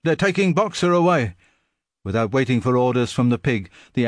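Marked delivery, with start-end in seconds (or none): 0.0-1.3 high, urgent call; urgent shout. A narrator's reading. Benjamin shouting urgently to the animals. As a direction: shout them in from the field.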